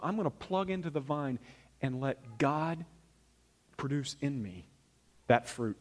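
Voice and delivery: soft, settled and unhurried; calm surrender. Acted. Preacher showing plugging into the vine, calm surrender. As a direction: let go gently, easing down into slow phrases.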